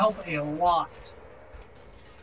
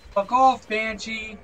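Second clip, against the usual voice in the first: angry yell; 3 semitones higher, 6 dB louder, slower than usual. Real, not acted. Player yelling at a Banshee that keeps attacking, angry. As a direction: yell it off in exasperation.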